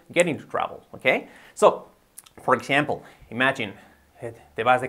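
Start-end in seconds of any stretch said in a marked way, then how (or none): 0.1-0.8 "get into trouble" run together as one phrase; quick, joined-up "get into trouble"; said quickly. Shown as a demonstration. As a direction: link "get into trouble" in quick connected speech. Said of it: "Di-, dicho rápido".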